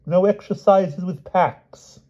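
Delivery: slow, mock-grave decree; a touch of pompous solemnity; solemnly. A narrator's reading. Palomides solemnly muddling a word, slow. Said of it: "said Sir Palomides solemnly".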